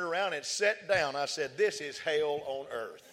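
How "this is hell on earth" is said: 1 laughing resignation. Retold or imitated. Sat down after falling.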